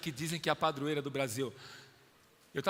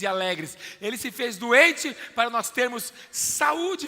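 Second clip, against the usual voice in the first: louder, higher sermon build; preaching fervently; 7 semitones higher, 8 dB louder than usual. Acted. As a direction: lift the church with conviction. Preacher rising in fervour. (pregação fervorosa)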